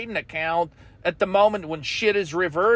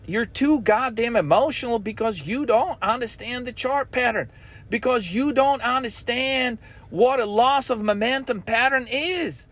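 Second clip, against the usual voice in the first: usual speaking voice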